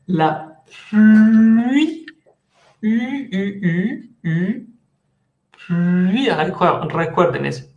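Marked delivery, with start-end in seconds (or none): 0.0-2.0 pluie drawn out, U lips sliding into I; teacher models "pluie" loud and slow; pronunciation demo. Shown as a demonstration. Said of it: "Prononciación de pluie". 2.8-4.7 "U, U" with rounded lips; lip-rounding drill; vowel drill. Shown as a demonstration. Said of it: "boca de U, pero dicen I".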